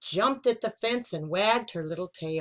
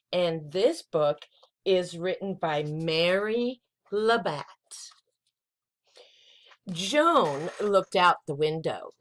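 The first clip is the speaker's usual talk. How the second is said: usual speaking voice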